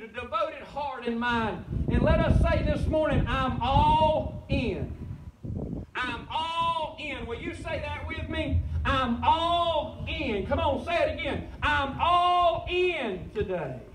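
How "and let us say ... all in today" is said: forceful rally cry with drawn-out key words; rousing preaching. Real, not acted. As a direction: preach it out for the crowd to repeat. Preacher leads congregation in declaration.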